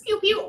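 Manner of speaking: high mock gun noise; finger gun pew. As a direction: make a playful pew pew gun sound. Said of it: "little finger guns".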